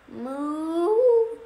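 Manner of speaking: high put-on moo; cow moo. Cows typing in the barn, high put-on moo. Cows mooing amid the clicks.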